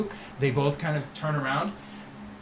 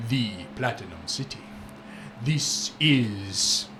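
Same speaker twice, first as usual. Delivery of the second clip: louder, sweeping showman voice; grand merchant boast; 4 dB louder than usual. Acted. Merchant Syrah boasting to the young friend, loud and showy. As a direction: boast like a flamboyant trader.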